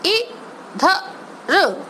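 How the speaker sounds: normal talking voice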